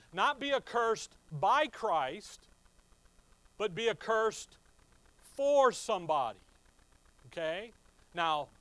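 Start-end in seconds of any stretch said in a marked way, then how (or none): 0.1-6.4 "by" lifted, then "for" punched loud; stress on by and for. Shown as a demonstration. Preacher contrasting by and for for listeners, loud. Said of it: "But notice what he says".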